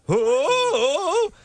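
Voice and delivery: very high-pitched, distressed cry; like you've never heard of your son's name. Shown as a demonstration. Host showing actress the mother's part, wildly distressed. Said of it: "the who is a very distressed who".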